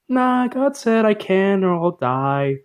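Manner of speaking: high, slow, hammed-up Eve; mock Eve. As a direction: play Eve as a prim young woman. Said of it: "Eve is all like".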